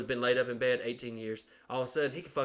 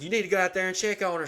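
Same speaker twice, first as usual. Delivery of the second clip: higher, fast scolding; mock scolding aunt; 7 semitones higher, 4 dB louder, faster than usual. Aunt on the phone scolding the son, higher and fast. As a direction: nag like the aunt. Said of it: "She's like".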